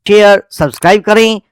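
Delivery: ordinary talk, nothing marked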